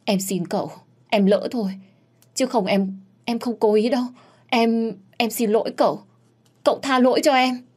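high, tearful begging; young woman sobbing to her master; sobbing plea. Acted. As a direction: beg forgiveness through tears. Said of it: "khóc nức nở".

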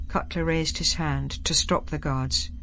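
usual speaking voice

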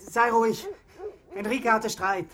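high, agitated reassurance; hasty cover-up. Acted. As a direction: brush mother off, loud and flustered. Boy fends off worried mother. (hastig abwiegelnd)

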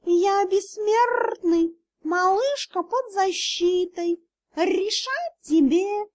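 high, very slow playful cat; cat spirit daring the officer; cheeky cat spirit. Acted. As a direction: tease him like a smug cat.

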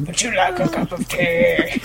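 high, laughing put-on butler voice; a silly high servant offering tea, through giggles; Hudson impression. Podcaster mocks her own Hudson impression, laughing and high. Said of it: "Or my Hudson impression".

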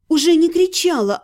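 plain reading voice

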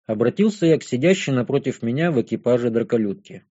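ordinary delivery

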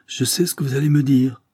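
plus aigu et plus fort; coupe sèchement. Acted. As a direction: interromps avec fermeté. Elle coupe la parole au duc. (interruption ferme et haute)

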